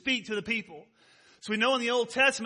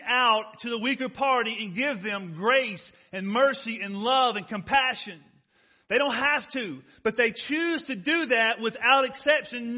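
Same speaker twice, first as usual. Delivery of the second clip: raised, emphatic pulpit delivery; preaching; 4 semitones higher, 5 dB louder than usual. Real, not acted. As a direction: drive each point home to the congregation.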